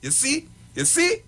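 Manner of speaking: high-pitched mock insistence, building; insistent bride. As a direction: keep pressing in short rising questions. Retold bride insisting to pastor.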